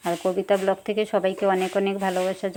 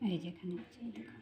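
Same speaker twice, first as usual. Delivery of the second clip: whisper; 3 semitones lower, 17 dB quieter than usual. Real, not acted. Unvoiced aside.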